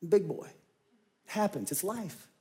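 a near-whispered brave face; quiet self-talk. Retold or imitated. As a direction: talk yourself down under your breath. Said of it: "I'm like".

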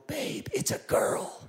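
high, slow, hushed excitement; retold joyful announcement. Husband telling wife during the birth, hushed delight. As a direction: break the big news in a high, airy voice.

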